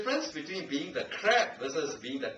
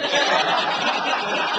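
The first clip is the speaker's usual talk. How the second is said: laughing; 9 dB louder than usual. Real, not acted. Laughing at guest joke.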